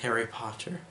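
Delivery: a quiet, mild imitation of a dying elf; dying Dobby. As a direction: let the name barely come out. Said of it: "and then Dobby just says".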